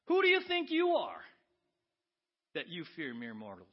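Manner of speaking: high, loud shout on do, then drops; rebuking the listener in a huge voice; booming rebuke. Acted. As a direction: thunder the challenge as God. Preacher voicing God's rebuke.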